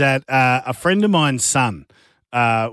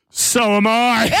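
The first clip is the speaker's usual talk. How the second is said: laughing; 9 semitones higher, slower than usual. Retold or imitated. Boss confessing, laughing.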